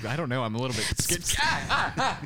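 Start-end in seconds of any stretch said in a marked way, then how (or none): 1.4-2.2 startled laughing yelps; sprayed with water. Real, not acted. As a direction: squeal as the water hits.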